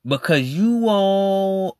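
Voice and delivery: ordinary talk, nothing marked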